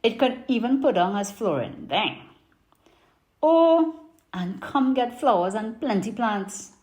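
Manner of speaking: put-on lively Trini market vendor accent; Trinidadian accent. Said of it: "I'm gonna do it in my best Trini market accent".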